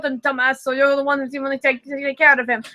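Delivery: ordinary talk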